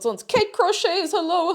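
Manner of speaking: ordinary talk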